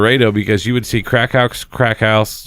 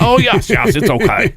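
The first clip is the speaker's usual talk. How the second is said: put-on Mexican accent; 11 semitones higher than usual. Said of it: "is that your Mexican accent?".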